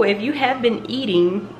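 ordinary talk

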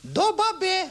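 very high falsetto taunt; cartoonishly high snake voice; inside-out snake. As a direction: squeak the scrambled word defiantly. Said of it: "She looked at him and says".

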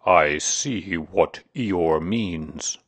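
very slow, drawn-out owl voice; stretched, self-important agreement; wise owl. Acted. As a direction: pronounce it ponderously. Owl speaks, pompous character voice.

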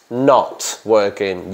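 NOT drawn out slowly and hit hard; stress on not. Shown as a demonstration. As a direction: lean long on not. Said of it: "not, not, not". (acento en NOT, lento)

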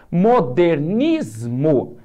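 Modernismo drawn out very slowly, leaning on nis; slow syllable stress. Shown as a demonstration. Teacher stretching a word for students, very slowly. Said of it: "temos uma palavra paroxítona".

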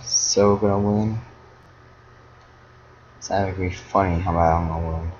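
soft muttering to yourself; hushed, confident murmur; quiet self-assurance. Real, not acted. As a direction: say it under your breath, absorbed in play. Muttering confidence while playing.

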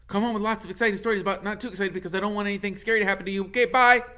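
high, eager squirrel chatter; squeaky excited squirrel voice; chatty squirrel. Acted. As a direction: pester him like a nosy little squirrel. Squirrel rambles farewell.

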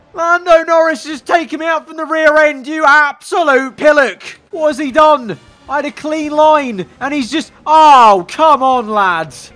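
high, loud exasperated rant; indignant outburst. Real, not acted. Gamer hit from the rear end, indignant outburst. Complains about being hit.

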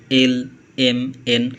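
slow, drawn-out এল, এম, এন; slow alphabet demo; careful letter names. Shown as a demonstration. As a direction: say each letter slowly and clearly. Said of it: "আরও একটু সুন্দর করে উচ্চারণ".

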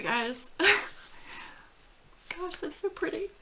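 high, tearful voice, real; choking up, voice climbing high and thinning out; emotional. Real, not acted. Dog owner reading a condolence note, emotional and tearful. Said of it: "the emotional part of that".

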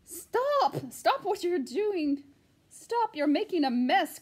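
high, loud alarmed hen cries stop; shrill cry. A narrator's reading. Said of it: "cried the first hen".

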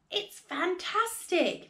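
high, drawn-out exclamation; excited shout. A narrator's reading. Father dancing round the giant hen, high excited cry. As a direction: exclaim slowly with sheer delight. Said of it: "Mister Cranky shouted".

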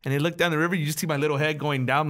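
ordinary talk

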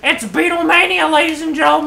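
high, loud showman announcement; hammed-up MC announcement; announcer. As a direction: announce it like an arena emcee. Announcing like an emcee.

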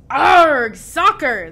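boisterous pirate chorus; crew yell. A narrator's reading. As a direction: echo the captain at full voice. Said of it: "the crew yelled".

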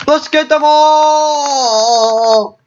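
slow, high rallying cry; triumphant. Real, not acted. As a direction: stretch out the battle cry. Triumphant call in the game.

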